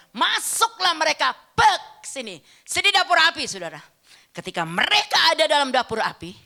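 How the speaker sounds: loud, high storytelling from the pulpit with suspenseful pauses; dramatic preaching. Real, not acted. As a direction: act out the story for the congregation. Preaches the furnace story at full force. (khotbah bercerita yang lantang dan dramatis)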